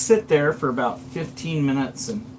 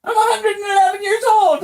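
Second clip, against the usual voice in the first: wailing; 8 dB louder, faster than usual. Acted. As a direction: wail it out, half laughing. Daryl wails about his age.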